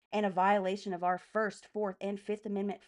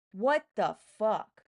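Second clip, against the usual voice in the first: outraged; 6 dB louder, slower than usual. Real, not acted. Outraged at being reported.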